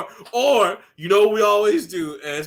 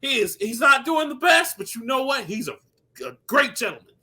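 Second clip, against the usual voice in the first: raised, scolding call to leave him be; loud protective scolding; mock defensive shout; 6 semitones higher, 4 dB louder than usual. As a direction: stand up for Mister Sonny, voice pushed up. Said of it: "It's like".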